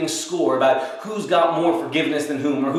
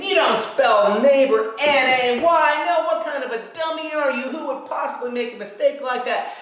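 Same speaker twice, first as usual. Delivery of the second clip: mock yelling; 7 semitones higher than usual. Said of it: "I start yelling at them".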